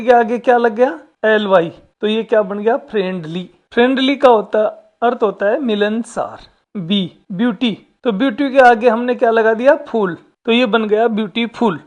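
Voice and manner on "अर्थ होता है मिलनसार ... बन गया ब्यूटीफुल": normal talking voice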